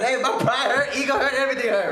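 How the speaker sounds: high-pitched laughing mock yell; mock shout. Retelling the fight with his brother, a high laughing mock shout. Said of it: "I'm shouting at him".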